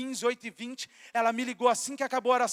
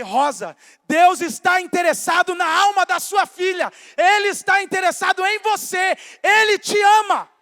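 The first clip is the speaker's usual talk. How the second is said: loud, high preaching shout; fervent shout; 5 semitones higher, 10 dB louder than usual. Retold or imitated. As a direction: proclaim it with full force. Retold preaching to mother, shouting. (pregação gritada com fervor)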